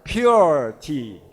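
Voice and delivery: "Pure tea" loud and drawn out, pyoo-er; model pronunciation. Shown as a demonstration. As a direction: pronounce "Pure" emphatically with a long oo. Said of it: "You won't go too far wrong with that".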